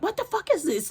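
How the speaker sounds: high, hushed disbelief; retold horrified question; shocked question. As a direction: gasp it in disbelief. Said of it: "I was like".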